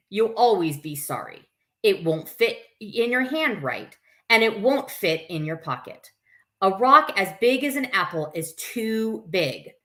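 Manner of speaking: put-on lively read-aloud to children; story reading. A narrator's reading. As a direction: read it to a circle of kids. Reads picture book aloud.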